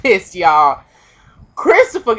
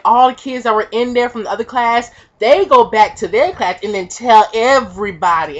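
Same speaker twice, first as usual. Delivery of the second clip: ordinary talk, nothing marked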